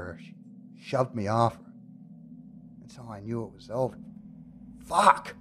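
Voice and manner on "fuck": angry curse. Acted. Rage at rejection.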